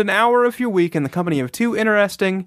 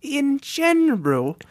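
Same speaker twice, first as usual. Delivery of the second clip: high, put-on English lady accent; subdued English lady; 9 semitones higher than usual. Chatting in the ladies' club, high mock English accent. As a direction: play a prim English lady, pitched up. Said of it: "subdued English lady".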